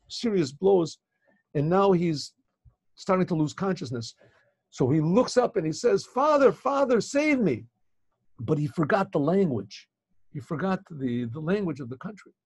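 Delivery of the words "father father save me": high, louder pleading call up to Father; desperate cry for help. Acted. Wounded son calling up to his father, desperate plea. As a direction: cry out to him like you're fading fast.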